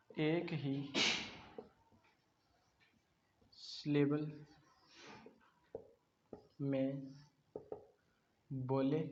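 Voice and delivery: dictating one word at a time, long gaps; spaced-out dictation pace; slow dictation. Shown as a demonstration. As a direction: read the rule out slowly like a dictation. Reads rule out word by word.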